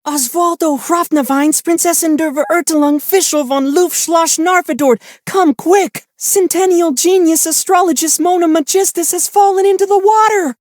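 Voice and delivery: put-on very high little-girl alarm cry; a frightened kid running in to tell; panicked child. Child's friends rush home to warn the family, in a very high panicked child voice. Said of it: "tell everyone".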